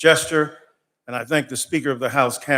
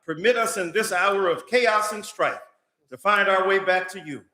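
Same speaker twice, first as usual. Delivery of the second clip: public prayer; 5 semitones higher than usual. Real, not acted. Pastor praying before the state House, impassioned preaching prayer. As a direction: pray it from the pulpit, building fire against injustice.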